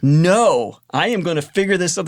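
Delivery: mock-frustrated, emphatic insistence; stubborn refusal. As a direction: act out digging your heels in.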